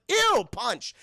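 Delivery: high, loud "ew"; loud grossed-out cry; mock disgust. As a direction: recoil with an exaggerated, high-pitched ew. Acted disgusted reaction to spit.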